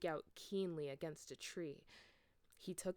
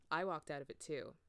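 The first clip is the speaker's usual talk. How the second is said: ordinary delivery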